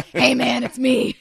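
smoker voice. Retold or imitated. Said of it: "He sounds like a regular smoker".

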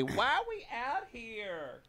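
high, soft, slow whine; whiny complaint. Retold or imitated. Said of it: "just say".

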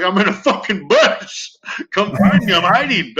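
high, drawn-out comic plea; mock desperate call. Hiding caller telling dispatch to come find him, mock desperate.

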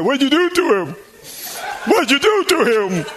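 high, mock-indignant demand; accusing outburst. Retells son angrily blaming his mother.

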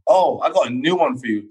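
ordinary talk, nothing marked

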